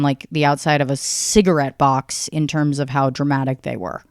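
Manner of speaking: ordinary talk, nothing marked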